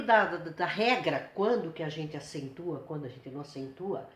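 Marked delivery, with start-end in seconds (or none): none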